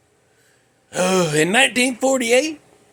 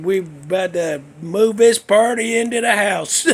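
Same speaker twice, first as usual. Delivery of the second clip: ordinary talk, nothing marked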